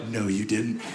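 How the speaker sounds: low mock-disapproving moms; scandalized moms. Moms in the audience scolding him, mock disapproval. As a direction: scold the dad for snooping. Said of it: "the moms in here are like".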